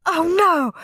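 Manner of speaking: high-pitched cry of alarm; dad's short, high panicked exclamation; mild scream. A narrator's reading. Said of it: "screamed Dad".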